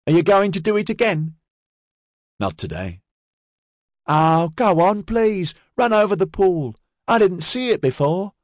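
put-on high young boy pleading; cheeky kid. A narrator's reading. Young boy begging the Water Walker, high and eager. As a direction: coax him like an eager kid.

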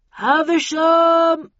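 loud, slow call through a megaphone; bullhorn voice. A narrator's reading. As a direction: boom it out over a bullhorn. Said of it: "a subdued voice on a bullhorn".

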